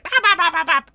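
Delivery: very high, loud rapid baps; freaked-out creature chatter. Acted. Game master as everyone freaking out at the blood, panicked squawks. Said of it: "as everyone's like".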